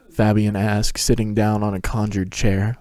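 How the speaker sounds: plain reading voice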